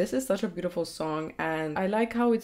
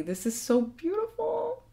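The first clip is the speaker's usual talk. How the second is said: high awed gush; 12 semitones higher than usual. Real, not acted. Moved by the song.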